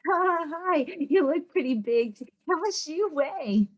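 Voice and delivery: breezy stranger asking something rude; nosy stranger. Retold or imitated. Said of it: "That's not acceptable".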